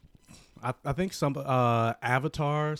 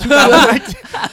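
laughing, higher and louder; laughing correction; 10 semitones higher, 15 dB louder than usual. Real, not acted. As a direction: laugh while fixing the year. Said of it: "I said two thousand nine".

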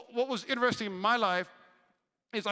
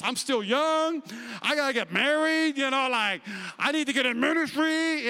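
higher, louder, half-shouted pleading; retold panicked plea; 4 semitones higher, 5 dB louder than usual. Scared flyer pleading with God on the plane, loud and high. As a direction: beg your way out of dying.

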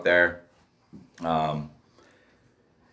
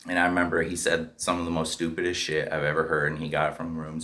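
usual speaking voice

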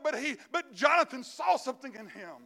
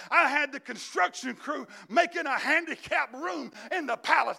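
preaching shout; 4 semitones higher, 6 dB louder than usual. Acted.

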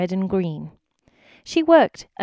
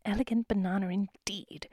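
quiet scornful mutter of elegant banana; 8 dB quieter than usual. A narrator's reading. Goat wife scoffing at her customer, muttered. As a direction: mutter it under your breath. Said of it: "muttered Missus G under her breath".

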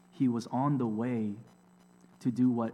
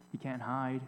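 usual speaking voice

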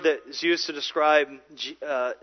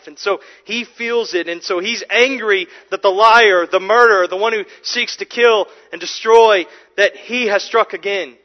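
louder, driving sermon cadence; preaching at full force; 4 semitones higher, 8 dB louder than usual. Acted. Pastor to congregation about Christ's anger at death, preaching loudly. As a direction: preach it hard, building passion.

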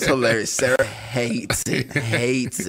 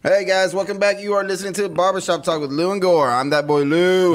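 higher, lively host greeting; radio show intro; 5 semitones higher, 3 dB louder than usual. Real, not acted. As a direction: open the show with punch on the name.

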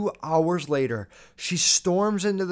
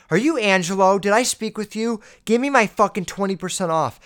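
raised, louder mock demand; mad customer; 4 semitones higher, 5 dB louder than usual. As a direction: imitate an irate shopper confronting a salesman. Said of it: "getting mad".

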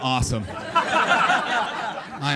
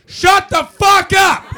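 much higher, louder Scottish screech; Scottish woman yelling; 14 dB louder, slower than usual. Retold or imitated. Scottish neighbour screaming at the choir, loud Scottish yell. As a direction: bellow it out the window like an angry Scottish woman. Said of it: "a very Scottish woman's voice erupts from a window".

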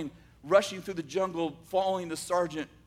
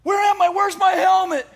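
much higher, much louder frantic shout; panicked yelling; 14 semitones higher, 13 dB louder than usual. Retold or imitated. Wounded soldier waking in hospital, a loud panicked shout. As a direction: scream it out in confusion. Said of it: "he starts yelling".

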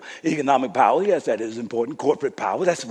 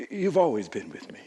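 tender father; 6 semitones lower, 9 dB quieter than usual. Retold or imitated. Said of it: "He says".

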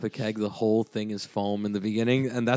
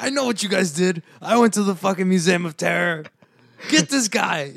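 menacing stranger; 6 semitones higher, 4 dB louder than usual. Pretend. Friend voices German stranger.

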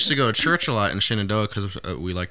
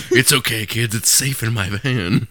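creepy stranger voice; 2 semitones higher, 4 dB louder, slower than usual. Pretend.

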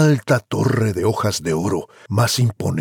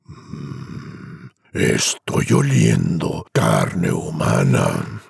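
very low, slow menacing giant voice; terrible giant; 9 semitones lower, much slower than usual. Acted. Giant sniffs out Juan, deep and menacing. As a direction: growl it slowly like a monster. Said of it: "habló una voz terrible".

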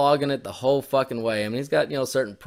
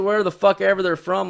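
ordinary talk, nothing marked

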